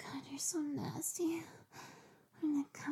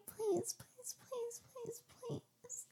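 begging; 7 semitones higher, 6 dB quieter, much slower than usual. Acted. Begging Daddy.

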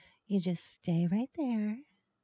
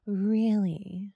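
normal talking voice

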